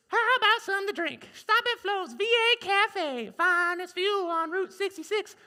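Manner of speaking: high, loud, sassy sales pitch; cafe owner pitch. Acted. Cafe owner inviting travelers in, high sassy pitch. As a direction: sell the cafe with flair. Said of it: "do all the dialogue".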